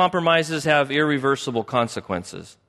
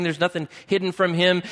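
ordinary delivery

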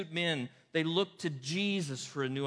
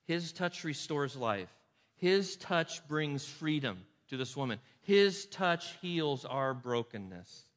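slow emphasis; slower than usual. Real, not acted. Sermon refrain slowed down.

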